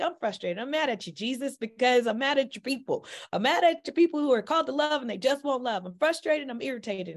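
retold frustrated venting. Woman venting at Jesus about his people, frustrated. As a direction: give God the business, replayed for listeners. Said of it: "I was giving God the business".

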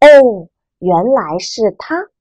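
哦 level and calm, 认识 stated plainly; flat statement tone. Shown as a demonstration. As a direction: say 哦 evenly, just stating. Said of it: "这个人我们都认识他". (哦，平直陈述的语气)

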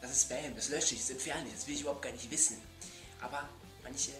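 usual speaking voice

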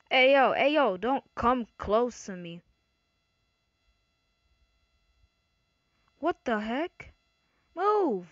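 loud panicked shouting; panicked yelling. Real, not acted. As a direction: yell at him to back off. Panicked player yelling at attacker.

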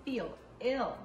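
put-on reading a picture book aloud to kids; story reading. Teacher reading a picture book to children, lively storytime. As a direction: read it out brightly for young listeners.

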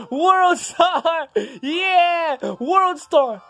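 high, loud whooping shouts with bursts of laughter; excited gamer hype. Real, not acted. Gamer cheering on a star power rampage, wildly excited. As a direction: yell it wildly, stretching the cries.

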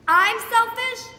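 outraged disbelief. Acted. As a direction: throw it back at him, stung. Arguing with father.

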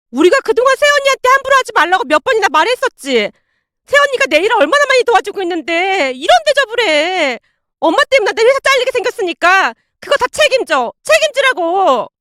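high-pitched, distraught blaming; acted wailing daughter, pitched high; wailing and yelling. A narrator's reading. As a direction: cry out your grievance at your mother. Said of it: "통곡을 하며 시어머님에게 소리를 질러대고".

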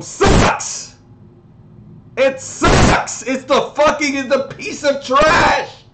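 high, loud outburst of disgust; over-the-top furious verdict; outraged shout. Acted. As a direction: yell it out, stretching the key words. Ranting about a terrible album.

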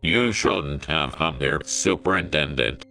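ominously. A narrator's reading. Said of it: "Skinner said ominously".

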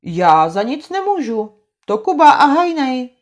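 high, loud protest; whining excuse. Acted. Trautenberg blames Kuba and Hajný, high whine. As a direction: wriggle out of blame.

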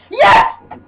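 high, short burst of triumph; excited shout. Real, not acted. Player pulling off a move, excited high shout.

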